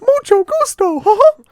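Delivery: very high, loud cartoon falsetto; high-pitched mouse voice; Mickey Mouse. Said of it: "speak Spanish as Mickey Mouse".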